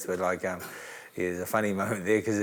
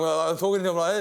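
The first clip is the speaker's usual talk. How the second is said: ordinary talk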